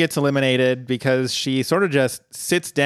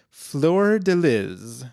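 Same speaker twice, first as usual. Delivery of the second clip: drawn-out, careful French accent; attempted French pronunciation; 2 semitones higher, much slower than usual. Shown as a demonstration. Host showing off a perfume name, best French accent. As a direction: stretch it slowly in clumsy French. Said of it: "That's my best French pronunciation".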